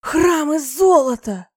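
high, loud, drawn-out delight; rapturous. A narrator's reading. Goddess dazzled by the promise of a golden temple, rapturous cry. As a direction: exclaim slowly, overcome with wonder. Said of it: "восторженное восклицание".

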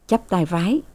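usual speaking voice

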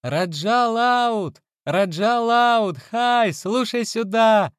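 very high, slow calling out; a little girl calling out loud; shrill child's shout. A narrator's reading. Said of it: "пронзительно кричала".

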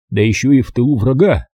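usual speaking voice

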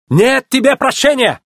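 very high-pitched indignant cry; angry condemnation. A narrator's reading. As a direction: condemn him, high and agitated. Luigi condemns the culprit.

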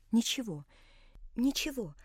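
soft, hushed insisting through held-back sobs; a quiet daughter's tearful reassurance; choking back tears. A narrator's reading. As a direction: murmur it as if tears are close. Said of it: "твердила она, задыхаясь от внутренних подавленных слёз".

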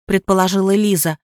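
usual speaking voice

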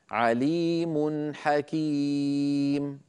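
slow chant of "عليم حكيم", long ee, tanween kept short; clear izhar. Shown as a demonstration. Teacher showing tanween before haa, "عليم حكيم" recited slowly and clearly. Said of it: "أيضا لا زيادة في زمن التنوين".

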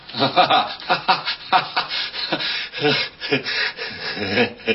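a long uneasy laugh, rising at the end; nervous laugh. Acted. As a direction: laugh it off, but the unease shows. Priest reacts to snowman corpse. (nervöses Lachen)

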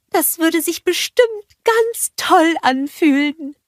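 longing to hug the sun; dreamy Olaf. A narrator's reading.

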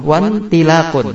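slow wantilakun, WA joined straight to N; hamzah wasal dropped. Shown as a demonstration. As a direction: say wantilakun slowly with the I swallowed by wawu. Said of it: "kalau kita kasih wawu menjadi". (wantilakun pelan, wa langsung masuk ke nun)

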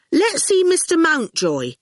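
put-on cheeky boy brushing off a giant; young boy. A narrator's reading.

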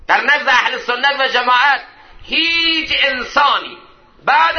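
high, ringing sermon oratory; full-force preaching. Acted. Preacher extolling Abu Bakr to the congregation, full-force oratory. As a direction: proclaim each phrase with rising fervour.

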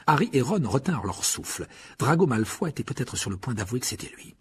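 ordinary delivery, nothing marked

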